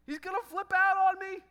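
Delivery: fearing the sponsor will explode; panicked thought. Retold or imitated. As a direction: blurt it out in alarm. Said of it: "and I'm like".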